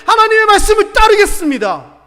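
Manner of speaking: loud, high, emphatic vow; resolute declaration. Acted. Believer vowing to follow God's word, loud and resolute. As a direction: proclaim it as a firm commitment.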